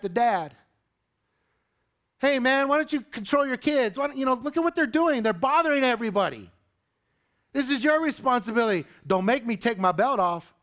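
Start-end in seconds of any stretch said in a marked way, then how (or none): 2.2-10.5 a higher-pitched retold rant at the dad; mock yelling. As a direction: play the irritated bus passenger, half-yelled. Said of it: "Just yelling at him".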